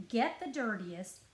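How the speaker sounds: plain reading voice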